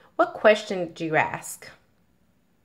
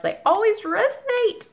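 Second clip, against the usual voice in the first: much higher, sing-song gush; delighted gushing; 13 semitones higher than usual. Real, not acted. To viewers about advice cards, high delighted gush. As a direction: let they always resonate leap up high with delight.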